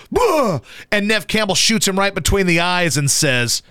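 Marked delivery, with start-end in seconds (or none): 0.1-0.6 mock jump-scare roar. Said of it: "And Ulrich goes".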